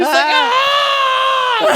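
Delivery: very high mock shriek; hammed-up furious yell; mad scream. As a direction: let out a drawn-out comic scream of rage. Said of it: "He's so mad".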